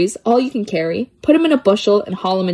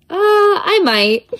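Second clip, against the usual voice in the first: high, slow, sheepish admission; a coy little confession, high and slow; sheepish squeak; 12 semitones higher, much slower than usual. Acted. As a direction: own up in a tiny high drawl. Sheepish answer to lie question.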